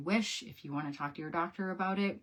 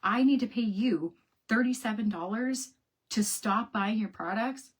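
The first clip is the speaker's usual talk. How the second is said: higher and louder, indignant; outraged disbelief; 5 semitones higher, 5 dB louder than usual. Retold or imitated. Complaining about a paid cancellation, outraged disbelief. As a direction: rant at the company in disbelief. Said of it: "I was like".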